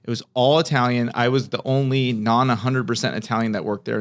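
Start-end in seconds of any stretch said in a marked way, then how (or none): none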